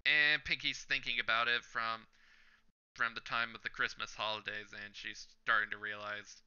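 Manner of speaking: ordinary talk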